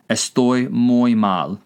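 slow, evenly spaced words; careful, drawn-out demonstration; slow language-teacher model. Shown as a demonstration. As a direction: say it slowly for learners to repeat. Teacher repeats phrase to translate.

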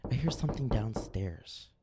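scared whisper. Acted. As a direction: hush it like a frightened kid. Scared kid alone at night.

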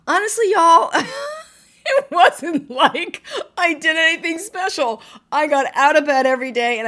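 high, loud tearful laugh breaking through; laughing through tears. Real, not acted. Remembering being called strong as a widow, laughing through tears. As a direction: laugh and cry at once, letting it spill out.

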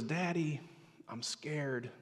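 softly voiced frightened daughter; hushed little confession to Daddy; small scared child. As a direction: gently imitate a scared child at night.

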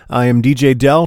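ordinary talk, nothing marked

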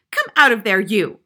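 loud, harsh command. A narrator's reading. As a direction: order the culprit out sharply. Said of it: "she said harshly".

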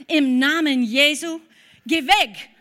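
loud, forceful rebuke in Jesus' name; commanding rebuke. Retold or imitated. To the shouting man in the car park, a loud rebuke. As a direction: order the stranger away with full force. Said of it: "Und das zweite Mal habe ich gesagt".

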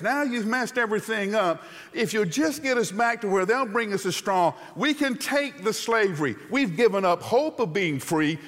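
high, loud complaining; resigned griping. Acted. Hebrews begging Moses to return them to slavery, high and loud. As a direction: plead to go back to the bricks. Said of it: "the Hebrews were trying to negotiate".

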